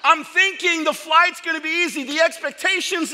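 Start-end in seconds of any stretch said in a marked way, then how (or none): none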